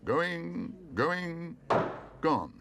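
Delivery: high rising calls, loud final gone; auctioneer calling. A narrator's reading. Auctioneer closes the sale on the bear. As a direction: drive the hammer down.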